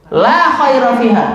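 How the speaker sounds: La khaira fiha declared with weight; solemn verdict on La; firm pronouncement. Retold or imitated. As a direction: stress on La, falling firmly. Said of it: "Rasulullah bersabda apa".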